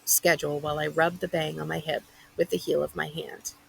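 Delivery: ordinary delivery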